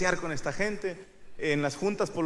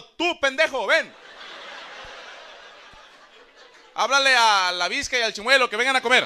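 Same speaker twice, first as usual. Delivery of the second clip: higher-pitched mock mom holler; mother calling out; 6 semitones higher, 4 dB louder than usual. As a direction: summon the kids to the table. Mother calling grandchildren to eat.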